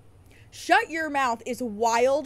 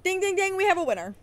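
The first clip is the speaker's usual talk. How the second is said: higher, louder game-show announcer call; mock game show; 8 semitones higher, 4 dB louder, faster than usual. Declaring the fan right, a hammed-up game-show call. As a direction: ring it out like a quiz host.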